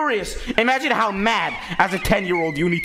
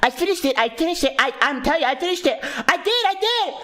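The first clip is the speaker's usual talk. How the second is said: high, pleading repeated "I finished it", climbing higher; insistent arguing; 7 semitones higher than usual. Retold or imitated. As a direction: keep insisting until your voice cracks upward. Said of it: "I was arguing with this hoe".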